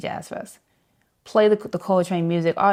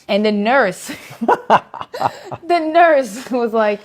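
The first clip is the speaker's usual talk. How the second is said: breaking up laughing mid-story; higher, louder, helpless laughing; real laughter; 7 semitones higher, 6 dB louder, much slower than usual. Real, not acted. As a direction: try to get it out through laughter. Cracks up telling the nurse bit.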